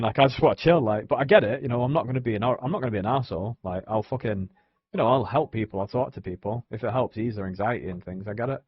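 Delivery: ordinary delivery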